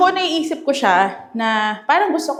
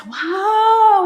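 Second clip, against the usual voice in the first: loud, high, drawn-out wow; amazed; 10 semitones higher, 5 dB louder than usual. Real, not acted. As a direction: exclaim it in big delight. Enters the spacious second room. (malakas na pagkamangha)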